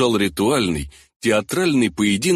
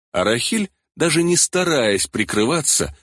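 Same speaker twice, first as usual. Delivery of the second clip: ordinary delivery, nothing marked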